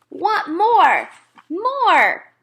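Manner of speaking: put-on high, loud toddler demand. A narrator's reading. Toddler Fudge asking for more.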